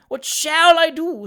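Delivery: high, loud, drawn-out wail; tearful despair. A narrator's reading. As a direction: wail helplessly as a distressed spinster. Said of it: "bursting into tears".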